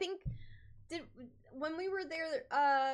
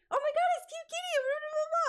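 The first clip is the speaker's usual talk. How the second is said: much higher, fast fan squeal; excited fan; 4 dB louder, faster than usual. Retold or imitated. Fan shouting her name down the hall, high squeal. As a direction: gush like a fan who just spotted her. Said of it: "it was just like".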